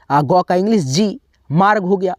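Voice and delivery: ordinary talk, nothing marked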